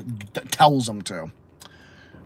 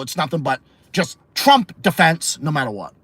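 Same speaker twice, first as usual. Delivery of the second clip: usual speaking voice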